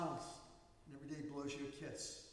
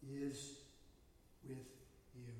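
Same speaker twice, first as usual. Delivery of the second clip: usual speaking voice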